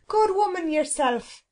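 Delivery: high, sharp comeback from the cook; indignant retort. Acted. Cook answering back to the phoenix, indignant. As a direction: throw the words back, high and affronted.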